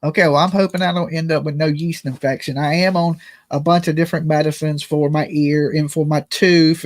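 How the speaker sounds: ordinary talk, nothing marked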